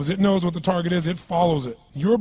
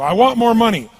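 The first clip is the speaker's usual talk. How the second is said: louder, higher demand; demanding whine; 6 semitones higher, 10 dB louder than usual. Retold or imitated. People asking life in general terms, a loud demanding whine. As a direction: blurt it like a grabby kid. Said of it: "People do it all the time. They go".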